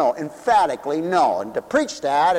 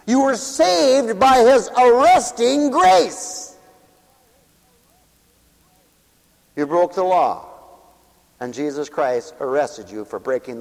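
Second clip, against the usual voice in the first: preaching; 4 semitones lower than usual. Acted. Preacher continues sermon.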